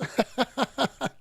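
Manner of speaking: short chuckle at his own quip; brief real laugh after a joke. Real, not acted.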